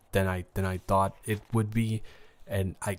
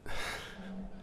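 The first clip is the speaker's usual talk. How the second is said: heavy sigh; 10 semitones higher, 11 dB quieter than usual. Acted. Exasperated sigh.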